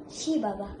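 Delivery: ordinary delivery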